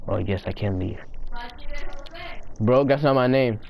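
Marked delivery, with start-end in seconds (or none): none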